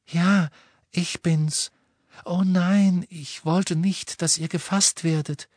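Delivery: high, airy dismayed voice; light girl's voice. A narrator's reading. Narrator voicing the girl Luna.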